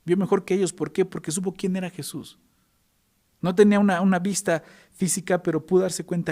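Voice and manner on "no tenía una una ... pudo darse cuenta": preaching. Acted.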